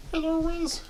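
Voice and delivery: very high-pitched pet-greeting voice; high doggy-talk. Real, not acted. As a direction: greet a dog in a high little voice. Vlogger greets his dog.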